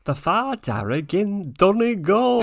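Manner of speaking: put-on high, slow hammy Donegal accent; hammed-up Donegal accent. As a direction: overdo the Donegal accent, drawn out. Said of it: "Can you do the Donegal accent?".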